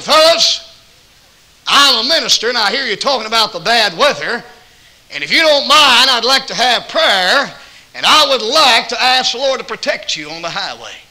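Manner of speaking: high and loud, announcing prayer; calling out to a room. Retold or imitated. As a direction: raise the voice to address the diner. Calling out to truck drivers.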